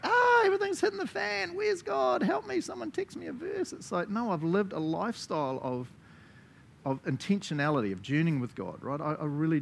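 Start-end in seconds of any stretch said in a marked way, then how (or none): 0.0-3.7 high, frantic cry for help; panicked believer. Pretend. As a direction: squeal it out in mock panic. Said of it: "It's not like".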